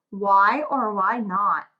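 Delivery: ordinary delivery, nothing marked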